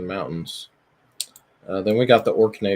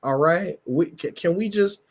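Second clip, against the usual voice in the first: high-pitched, fed-up plea; exasperated plea; 9 semitones higher than usual. Retold or imitated. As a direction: whine it up high like a fan who's had enough. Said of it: "And it's like".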